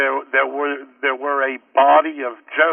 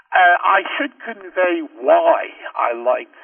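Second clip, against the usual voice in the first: ordinary delivery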